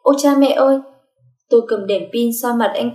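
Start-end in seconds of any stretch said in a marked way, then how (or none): 0.0-0.8 high, loud cry of alarm; startled, acted exclamation; startled yell. A narrator's reading. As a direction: yell out in fright. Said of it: "Tôi hét lên".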